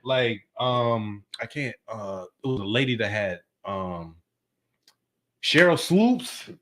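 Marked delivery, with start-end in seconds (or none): 5.4-6.5 slow, stretched recall; drawn-out name. Real, not acted. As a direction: drag the name out slowly.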